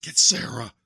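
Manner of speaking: loud strained urgent command; urgent plea. Acted. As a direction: force the order out. Dying uncle urges nephew.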